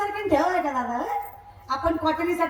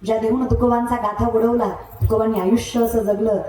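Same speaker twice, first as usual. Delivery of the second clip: loud, lower impassioned kirtan preaching; preaching at full force; 7 semitones lower, 4 dB louder than usual. Acted. Preacher rousing the village crowd about saints, loud and fervent. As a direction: build the sermon into a ringing loud climax.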